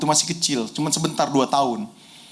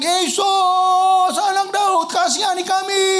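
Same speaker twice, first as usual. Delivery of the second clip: desperate shout; 14 semitones higher, much slower than usual. Retold or imitated. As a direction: call out to Jesus across the distance, begging. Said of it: "dia teriak".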